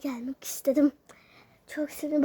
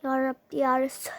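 ordinary talk, nothing marked

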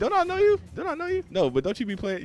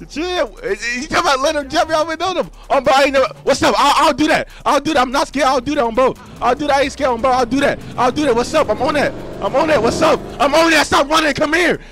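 panicked shouting; 12 dB louder than usual. Real, not acted. Panicked yelling during in-game fight.

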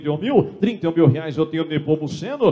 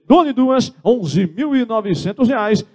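auctioneer call; 6 semitones higher, 3 dB louder, slower than usual. Real, not acted. Auctioneer calling going twice.

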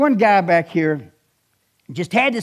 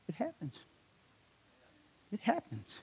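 dropped to a much softer, almost breathy repeat; hushed emphasis; 4 semitones higher, 17 dB quieter than usual. Acted. Preacher quietly repeating that whatever happens, happens. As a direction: let it fall away softly.

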